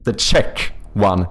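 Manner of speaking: "Czech" said slowly as one clean syllable "chek", no s at the end; slow careful correction. Shown as a demonstration. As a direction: draw out "The Czech one" to correct the earlier slip. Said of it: "The Czech one, not the Czechs one".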